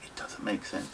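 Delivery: very soft, low, almost whispered; barely voiced, to oneself; real hushed disbelief. Real, not acted. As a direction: murmur it in despair. Despairing after searching for words.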